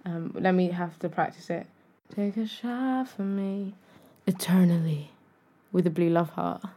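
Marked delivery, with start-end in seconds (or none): 2.2-6.6 smooth, quiet celebrity impersonation. Retold or imitated. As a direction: murmur it low and soft, nearly whispered. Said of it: "let me do it in my [name] voice".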